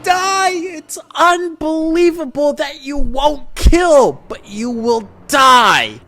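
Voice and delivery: very high, slow, drawn-out scream; maniacal gloating. Acted. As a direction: stretch each word in a crazed high yell. Villain gloats over cornered Batman.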